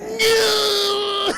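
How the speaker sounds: high, loud drawn-out wail; mock climactic cry. Streamer mimics Anakin as he touches her back, mock climactic cry. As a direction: cry it out absurdly high and long.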